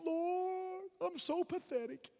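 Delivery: high, drawn-out whimpering wail; hammed-up self-pitying cry; mock crying. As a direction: plead pathetically in a put-on whine. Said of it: "all the crying in the world".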